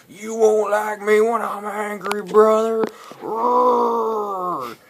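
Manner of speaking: high, loud mock wrestler bellow; Hulkster yell. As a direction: roar it like a hammy pro wrestler threatening. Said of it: "and he'd always yell".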